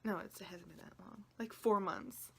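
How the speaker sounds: normal talking voice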